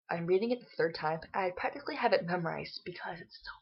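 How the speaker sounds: ordinary talk, nothing marked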